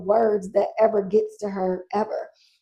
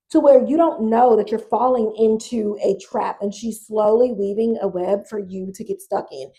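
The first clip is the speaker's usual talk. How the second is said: usual speaking voice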